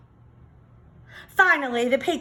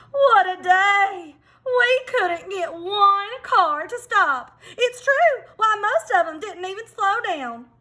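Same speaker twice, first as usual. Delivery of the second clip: exhausted hens; 8 semitones higher, 4 dB louder than usual. Acted. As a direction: moan like worn-out hens after a long day.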